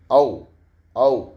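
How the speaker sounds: औ said open and rounded; clear au vowel. Shown as a demonstration. Teacher models the sound for students: open rounded औ. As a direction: shape the lips for a full औ. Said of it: "इसका उच्चारण इस तरह से हुआ".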